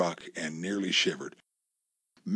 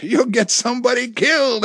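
higher, louder alarmed shout; 11 semitones higher, 4 dB louder than usual. A narrator's reading. Wife shouts at husband blocking the trail. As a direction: yell the warning at him. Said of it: "Amy shouted".

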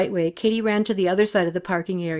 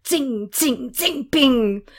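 high, ringing bullet zings; pitched-up voiced ricochet noises; imitated ricochets; 6 semitones higher, much slower than usual. A narrator's reading. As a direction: make bullets whizz past.

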